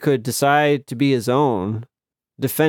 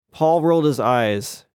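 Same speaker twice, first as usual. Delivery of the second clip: usual speaking voice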